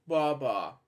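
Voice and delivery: usual speaking voice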